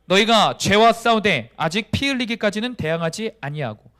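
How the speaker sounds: loud opening, firm measured reading; congregational scripture reading. Acted. Preacher leading the congregation in reading Hebrews, firm recitation. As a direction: lead everyone through the verse firmly. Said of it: "한 목소리 한번 읽어보겠습니다".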